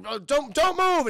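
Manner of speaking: loud, high, slowed order; acted stammering police shout; police command. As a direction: order a suspect to freeze.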